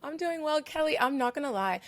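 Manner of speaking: normal talking voice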